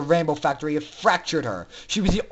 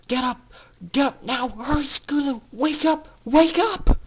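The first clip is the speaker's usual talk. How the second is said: frantic call; 7 semitones higher than usual. A narrator's reading. Aurora rousing Scootaloo before the guards arrive, frantic rising shouts. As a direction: yell her awake, rising in panic.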